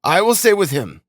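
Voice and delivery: high, emphatic offer; eager cry. A narrator's reading. Razumihin volunteering to stay with his friend, high eager cry. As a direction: cry it out decisively. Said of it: "cried Razumihin".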